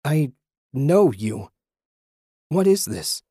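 high-pitched, startled questioning; bewildered and rattled; alarmed confusion. A narrator's reading. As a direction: confront the apparition, voice jumping up. Hurt man confronts apparition.

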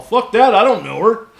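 mock indignant outburst, high and loud; loud refusal. Friend refuses to rescue stranger, loud dismissal. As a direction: imitate a friend loudly refusing.

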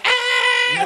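very high imitated shriek; mock scream. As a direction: screech like the outraged lady. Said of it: "She turns around like".

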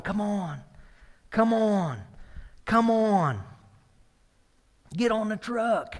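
a hint of kids cheering him on; calling out. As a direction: call him onto the truck like excited kids. Kids calling kidnapper onto truck.

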